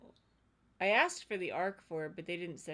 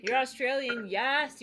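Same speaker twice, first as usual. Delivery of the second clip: excited; 5 semitones higher, 8 dB louder than usual. Real, not acted. Excited about the accent.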